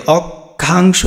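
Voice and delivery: অক্ষাংশ slowly as "ok... khang-sho"; syllable by syllable. Shown as a demonstration. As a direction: pause after the first syllable of অক্ষাংশ. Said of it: "নিজে নিজে উচ্চারণ করতে হবে". (ধীরে ভেঙে "অক... খাংশো")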